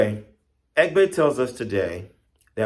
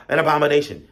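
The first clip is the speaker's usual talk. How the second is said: raised, louder quoted cry; imitated scream; 7 semitones higher, 8 dB louder, much faster than usual. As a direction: pass on the alarm as a mild mock yell. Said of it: "they were screaming".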